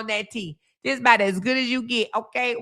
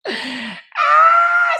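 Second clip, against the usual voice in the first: scream of laughter; 8 dB louder than usual. Real, not acted.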